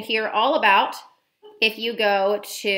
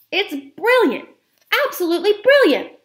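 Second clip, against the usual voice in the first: much higher, loud grinning cry of praise; delighted shout; 10 semitones higher, 7 dB louder than usual. A narrator's reading. Grandmother praises the boy's idea, delighted grinning shout. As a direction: shout it with a huge grin. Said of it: "a great grin spread over her face and she shouted".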